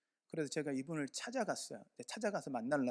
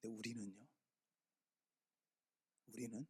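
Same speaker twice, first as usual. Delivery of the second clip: much softer, pointed question to the room; hushed question; 4 semitones lower, 11 dB quieter than usual. Real, not acted. Pastor turning the story on listeners, hushed. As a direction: lower the voice to confront them. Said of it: "turning question to congregation".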